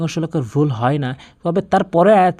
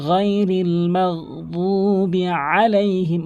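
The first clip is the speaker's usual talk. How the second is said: slow, higher recitation with pressed ain; Quran recitation; 4 semitones higher, much slower than usual. Shown as a demonstration. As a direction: recite with held vowels, squeezing into "আলাইহিম". Recites the verse as demonstration. (ধীরে টেনে তেলাওয়াত)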